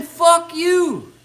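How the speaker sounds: a high, scornful fuck off; sneering curse. Acted. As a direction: spit the words out high and hard. Sneering dismissal of the neighbor.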